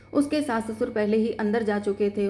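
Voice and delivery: ordinary delivery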